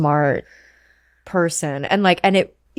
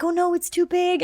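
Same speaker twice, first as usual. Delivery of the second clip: imitating worried fiancé; 9 semitones higher, 4 dB quieter than usual. Imitating fiancé fretting over ring.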